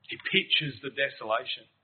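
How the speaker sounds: ordinary delivery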